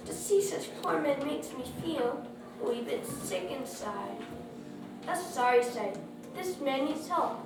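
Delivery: plain reading voice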